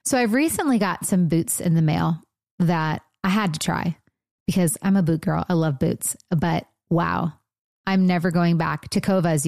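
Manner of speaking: tidy podcast host ad delivery; sponsor ad read. Acted. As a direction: read the boot ad copy evenly. Reads a sponsor ad.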